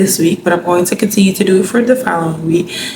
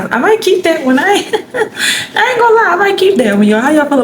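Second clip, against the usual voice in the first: laughing; 10 semitones higher than usual. Real, not acted. Delighted with her own idea.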